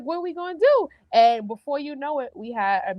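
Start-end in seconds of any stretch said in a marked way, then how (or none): 0.0-0.9 worried question. Retold or imitated. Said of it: "And we're like".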